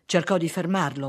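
usual speaking voice

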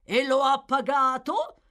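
loud, high, rising challenge; pointed question. Acted. Magistrate challenges the bookseller, loud and pointed. Fang pounces with a suspicious question.

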